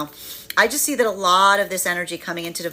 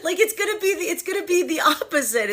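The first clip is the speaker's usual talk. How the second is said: high-pitched, squeaky with laughter; laughing; 13 semitones higher than usual. Real, not acted. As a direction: keep talking through the giggles. Still laughing at her own joke.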